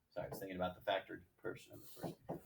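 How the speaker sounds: muttered quick correction; turned away from the mic; quiet aside. Real, not acted.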